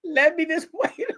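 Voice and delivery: high, laughing mockery; laughing through words. Real, not acted. Mocking the plaintiff who missed the hint, laughing. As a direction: talk while laughing at him.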